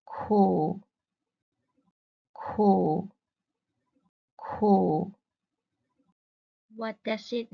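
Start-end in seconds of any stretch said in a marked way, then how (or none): 0.1-5.1 "Ku" low and dipping, louder; teacher modelling "Ku" on a low dipping tone; Mandarin dipping tone. Shown as a demonstration. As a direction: drop "Ku" down low, then lift it slightly. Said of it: "Listen carefully".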